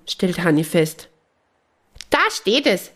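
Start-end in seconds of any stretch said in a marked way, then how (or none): 2.1-2.9 high excited call out; bright high cry of discovery; excited shout. Acted. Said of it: "schreit Kali".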